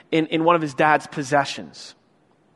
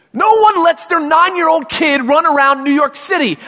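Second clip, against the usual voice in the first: higher and louder, worked up; fired-up outrage at bad parenting; indignant rant; 9 semitones higher, 6 dB louder than usual. Real, not acted. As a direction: scold the movie's parenting. Ranting about the mother.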